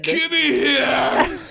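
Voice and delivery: slow, hammed-up sickly groan; fake sick voice. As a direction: stretch it out like faking illness. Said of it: "the hardest "I'm sick" voice".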